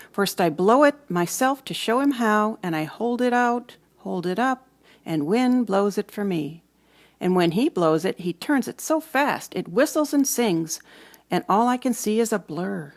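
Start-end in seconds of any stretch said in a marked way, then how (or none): none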